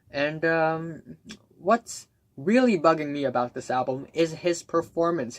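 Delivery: normal talking voice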